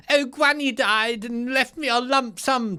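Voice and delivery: high, slow, airy mock toff voice; spoilt heir type.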